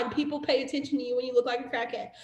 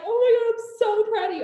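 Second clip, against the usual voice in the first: high, louder burst of mock delight; gushing upline; 8 semitones higher, 5 dB louder than usual. Upline reacting to a team member's win, excited gushing. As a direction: play the thrilled mentor. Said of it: "Your upline's gonna be like".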